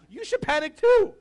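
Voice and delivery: loud, high panicked demand; panicking. Acted. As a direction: push your panic onto someone at full volume. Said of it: "it's like when I'm panicking".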